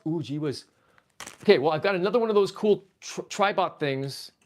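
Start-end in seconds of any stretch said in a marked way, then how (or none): none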